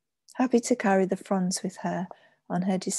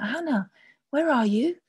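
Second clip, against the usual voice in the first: high, raised call; 6 semitones higher, 4 dB louder than usual. A narrator's reading. Young mistress to Hannah in the adjoining room, calling out. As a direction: call out for someone in the next room. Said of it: "She called out".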